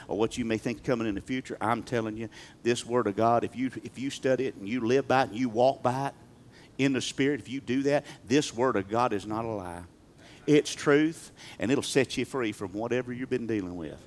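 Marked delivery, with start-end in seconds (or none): none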